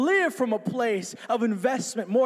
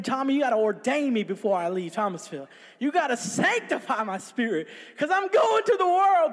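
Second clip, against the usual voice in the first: eager plea rising to a high cry; imitating an over-excited young believer; acted-out excitement; 4 semitones higher than usual. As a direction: build from earnest pleading to hyped-up declaring. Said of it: "I'm all excited".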